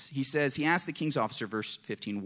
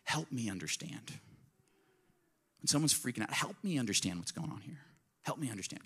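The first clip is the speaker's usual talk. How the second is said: calm question; 8 dB quieter than usual. Shown as a demonstration. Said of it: "Just say".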